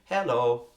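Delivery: "Hello" punched on HEL, against normal accent; loud "Hello" accented on its first syllable; stress on first syllable. Shown as a demonstration. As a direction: throw the weight onto the start of "Hello". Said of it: "There's nothing wrong with saying".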